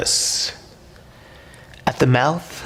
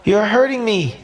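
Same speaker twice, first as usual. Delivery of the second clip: pained scream; 11 semitones higher than usual. A narrator's reading. Squeezed fish screaming at the octopus in pain. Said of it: "Majib screams".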